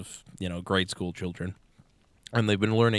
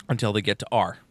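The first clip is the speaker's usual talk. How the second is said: normal talking voice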